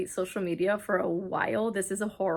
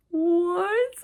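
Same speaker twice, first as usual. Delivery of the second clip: high-pitched, re-enacted astonishment; mock-stunned, rising high at the end; stunned disbelief; 9 semitones higher, 4 dB louder than usual. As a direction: squeal it as a baffled reader. Re-enacting her stunned reaction while reading.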